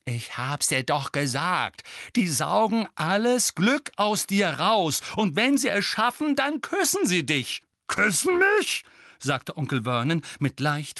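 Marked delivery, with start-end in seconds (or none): 0.0-7.6 slow, loud, climbing higher; boy explains dementors impatiently; exasperated. A narrator's reading. 7.9-8.8 shrill leap up on mich; aghast disbelief; shocked. A narrator's reading. Vernon shocked.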